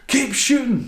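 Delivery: high, loud mock shout; manic yell. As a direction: bark the order like an unhinged cop. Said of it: "where he's like".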